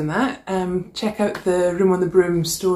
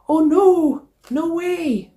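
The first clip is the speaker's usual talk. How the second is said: dismayed gasp; 12 semitones higher, 4 dB louder, slower than usual. A narrator's reading. Bow blows away in story.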